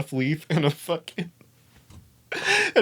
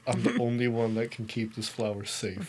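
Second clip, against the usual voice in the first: much softer, lower, earnest guardian; solemn hushed vow; 4 semitones lower, 9 dB quieter than usual. Retold or imitated. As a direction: say it low like a sworn protector. High, solemnly vowing to guard flower.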